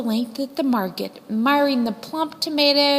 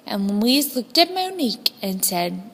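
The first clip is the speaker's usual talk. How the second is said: usual speaking voice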